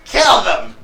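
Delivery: very high, loud exasperation; flustered kobold boss. Acted. Bandit boss muddling his own threat, loud and flustered. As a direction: concede it in a high, exasperated yell.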